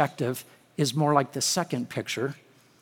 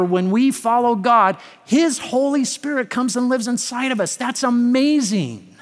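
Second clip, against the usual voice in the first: higher, driving sermon voice; impassioned preaching; 6 semitones higher, 4 dB louder than usual. Real, not acted. Preacher telling the congregation God reveals himself, impassioned preaching. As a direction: press the point on the congregation, pitched up.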